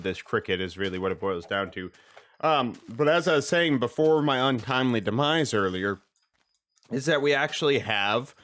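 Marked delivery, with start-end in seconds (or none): none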